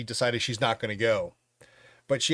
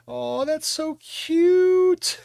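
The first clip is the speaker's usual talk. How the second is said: very high, slow, sugary coo; drawn-out high coo like melting over something adorable; cooing girlfriend; 7 dB louder, much slower than usual. Retold or imitated. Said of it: "Jules's girlfriend goes".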